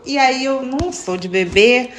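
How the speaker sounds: ordinary talk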